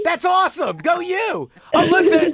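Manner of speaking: high, loud cheering; excited cheer. Real, not acted. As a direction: cheer her on, delighted. Cheering diver on penguin cam.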